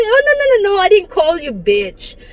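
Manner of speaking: high, rapid flustered backpedalling; alarmed denial. Retold or imitated. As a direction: hastily protest your innocence. Acted hasty denial of insult.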